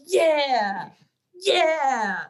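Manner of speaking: high, loud laughing cheers; delighted squeals. Real, not acted. Friend thrilled to be picked, cheering loudly. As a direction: squeal with real joy at being chosen.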